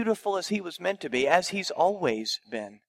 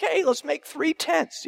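higher, slower, bemused imitation of Peter; crazy Peter; 10 semitones higher, 3 dB louder than usual. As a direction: play Peter blurting a half-asleep idea. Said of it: "crazy Peter talk".

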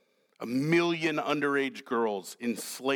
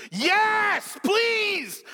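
high, loud, drawn-out mock scream; David's frustrated inner scream at Uriah; inward scream; 5 dB louder, much slower than usual. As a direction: scream it out, exasperated, dragging the last words. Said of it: "David is screaming inwardly".

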